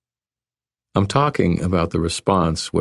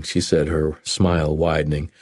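ordinary delivery, nothing marked

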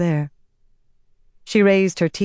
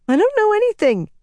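high, fast frightened squeak; 5 dB louder, faster than usual. A narrator's reading. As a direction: squeak it out in panic, much higher. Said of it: "the boy squeaked".

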